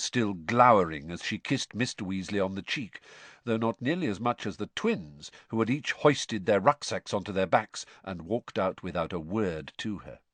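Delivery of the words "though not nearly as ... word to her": ordinary delivery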